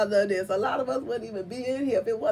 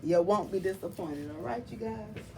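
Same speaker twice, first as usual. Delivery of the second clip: ordinary delivery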